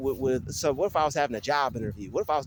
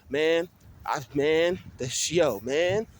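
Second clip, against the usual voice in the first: normal talking voice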